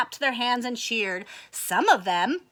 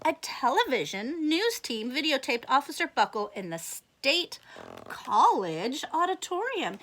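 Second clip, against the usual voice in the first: plain reading voice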